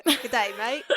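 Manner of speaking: jokey, amateur Aussie greeting; mock Australian accent. Co-host joining in the bit, mock Aussie accent. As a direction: toss out a badly faked Aussie "mate". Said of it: "my terrible accent".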